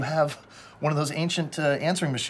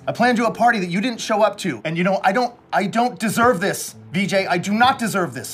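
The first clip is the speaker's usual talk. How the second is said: louder, higher wounded complaint; hurt anger; 5 semitones higher, 4 dB louder than usual. Acted. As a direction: let the hurt come out loud. Hurt angry voicemail continues.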